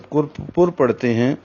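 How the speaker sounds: ordinary talk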